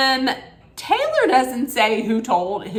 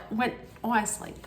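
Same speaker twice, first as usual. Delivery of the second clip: ordinary delivery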